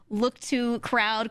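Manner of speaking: ordinary delivery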